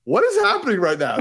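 high, loud astonished outburst; amazed disbelief. Retold or imitated. Viewer reacting to the turtles' banter, amazed disbelief. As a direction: exclaim it in giddy bewilderment.

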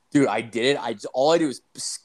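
ordinary delivery

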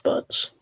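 "Butts" with a short vowel; offered pronunciation. Shown as a demonstration. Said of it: "Is that how you pronounce it".

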